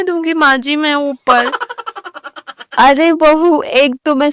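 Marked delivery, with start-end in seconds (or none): 1.5-2.7 giggle. Acted. Daughter-in-law laughs at wrong time.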